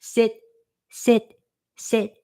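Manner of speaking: high sing-song sit, sit, sit; mock dog command. Vlogger imitating her host commanding the dog, high and sing-song. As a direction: imitate her ordering the Rottweiler. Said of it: "sometimes she's like".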